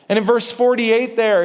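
ordinary talk, nothing marked

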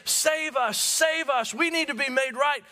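mock high-pitched pious cry for salvation; mock pious plea. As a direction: lift it high like a tidy Sunday-school version. Mocking the misread meaning.

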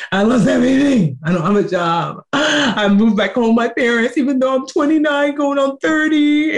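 high, tearful retelling of breaking down; retold crying confession. Telling her best friend everything, high and tearful. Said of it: "I just started crying and told her".

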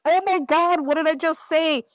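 hammed-up shocked teen reader; mock panic. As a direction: replay the moment she realised she'd blundered. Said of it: "I did some readings that were like".

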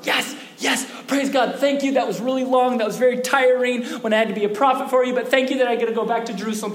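high, tumbling words of thanks; elated relief. Acted. Prophet thanking God on being freed, excited relief. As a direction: gush thanks to God, speeding up as you go.

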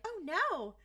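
small, high, creepy villain impression; creepy little voices. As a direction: put on creepy little voices, mock-dismayed. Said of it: "in their, like, creepy little voices".

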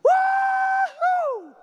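very high, loud woo-hoo; a soaring whoop of glee; Elijah's whoop. Pretend. Elijah taken up to heaven, a soaring joyful woo-hoo. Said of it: "He was like".

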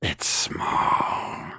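very slow rasping whisper; menacing witch. Acted. Unseen witch appraising strangers, menacing slow whisper. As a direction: hiss it out slowly, all breath and rasp. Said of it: "that is menacing".

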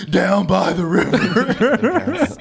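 high, loud, slow hammy shout; Chris Farley motivational speaker. Retold or imitated. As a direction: bellow it like a washed-up speaker. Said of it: "movie quote reference".

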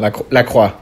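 French-accented La Croix on request. Shown as a demonstration. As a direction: say the brand name with a French accent, loud and falling. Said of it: "Say it with the French accent".

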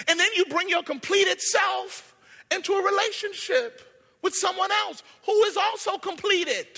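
high, loud, shouted sermon; full-force preaching. Real, not acted. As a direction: cry it out to the whole congregation.

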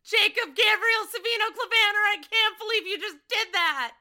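loud, high outburst of disbelief; high-pitched incredulous yell; shouted disbelief. Real, not acted. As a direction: explode in mock outrage, drawing out the first words. Outburst at game master's reference.

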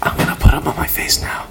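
unvoiced ASMR whisper. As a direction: hush it into the mic. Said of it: "A S M R".

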